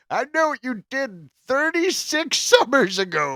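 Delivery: high, loud hammed-up delivery; mock movie villain line. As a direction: sneer it like a ridiculous slasher tagline. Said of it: "you can't be like".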